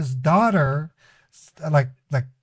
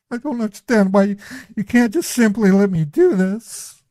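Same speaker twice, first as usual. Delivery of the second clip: higher-pitched, quick, put-on pleading; mock desperate plea; 6 semitones higher, 3 dB louder than usual. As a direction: act frantic to guilt the caller. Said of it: "I can act".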